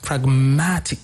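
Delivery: pragmatic said as prag-MAT-ic; stress on second syllable. Shown as a demonstration. Teacher giving a second word with the same stress, second-syllable stress. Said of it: "they work the same".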